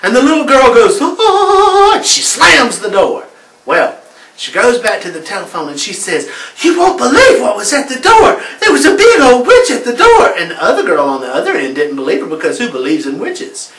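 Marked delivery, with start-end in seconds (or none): 1.0-1.9 short high startled shriek; high-pitched gasp of fright; startled scream. Acted. Girl sees witch at door. 6.6-10.3 higher, laughing excited rush; breathless with news of the witch; giggly, raised excited voice; excited telling. Acted. Girl tells friend on phone.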